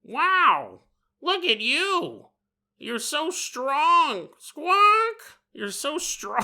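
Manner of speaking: high, drawn-out squawky gushing; high-pitched bird voice breaking into a squawk; crazed Big Bird. Acted. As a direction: coo admiringly like a giant deranged bird. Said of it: "laughing maniacally".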